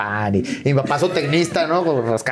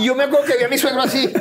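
ordinary delivery

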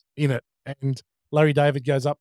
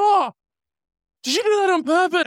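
high, loud, fast outraged squeal; hammed-up indignant accusation, high and fast; Larry David outraged; 5 dB louder than usual. Retelling the Curb scene, Larry squeals at Michael J Fox in outrage. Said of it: "Larry David's like".

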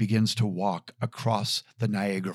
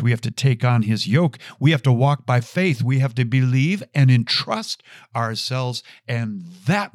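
usual speaking voice

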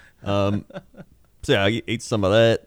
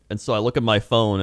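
ordinary delivery

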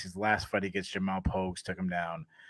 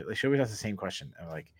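ordinary talk, nothing marked

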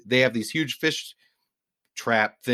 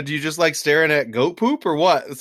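higher, louder mock teasing; busting chops; 5 semitones higher, 5 dB louder than usual. Scottish fisherman ribbing tourists, high and loud teasing. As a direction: rib them with a pitched-up jab. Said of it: "busting our chops".

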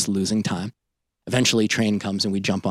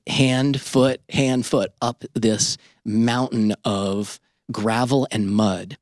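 ordinary talk

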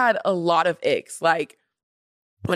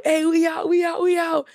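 higher hyped-up dude voice, mocking; mock club bro; 10 semitones higher than usual. Mocking club guys filming their bottle, high hyped bro voice. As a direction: ham up a guy bragging on Snapchat.

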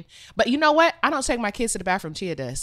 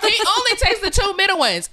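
high-pitched comic protest; laughing indignation; 12 semitones higher, 4 dB louder than usual. Real, not acted. Mock indignation about husband, amid laughter.

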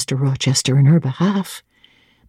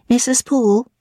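a higher cook's voice; lightly voiced cook, brisk and higher; the cook calling Grace Poole down to dinner, higher; 6 semitones higher than usual.

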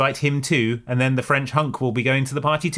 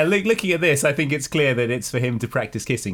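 normal talking voice

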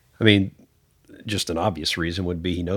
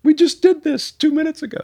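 high, loud sitcom protest; hammed-up outraged whine; mock exasperation; 6 dB louder than usual. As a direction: complain like an indignant ticket holder. Acts out Seinfeld's George protesting.

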